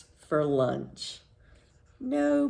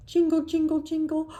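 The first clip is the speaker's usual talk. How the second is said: bell jingle; 9 semitones higher, 3 dB louder, faster than usual. Pretend. Cat's bell jingles in story.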